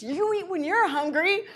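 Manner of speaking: high cheeky dog voice; high pretend dog talking back. Voicing the dog.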